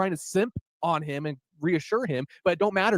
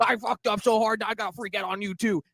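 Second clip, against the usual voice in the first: high, strained mock rant; mock angry outburst; 5 semitones higher than usual. Mocking Buckman lashing out at his co-host, high and shrill. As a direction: squeal it out like a man caught red-handed. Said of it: "He's like".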